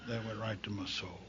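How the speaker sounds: quiet, heavy repeat; hushed repeat. Real, not acted. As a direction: let "soul" fall away, barely audible. Repeat sinking to near silence.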